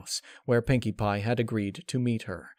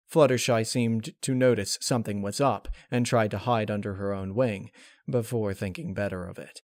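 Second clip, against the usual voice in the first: ordinary delivery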